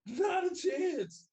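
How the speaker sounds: loud, high and slow, with a laugh; drawn-out shout. Real, not acted. Turning down the young homie, a slow laughing shout. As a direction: stretch it out as a big laughing refusal.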